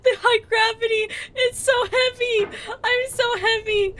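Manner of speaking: high, whiny fake sobbing; mock crying. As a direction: wail like you're being crushed. Strained crying under high gravity.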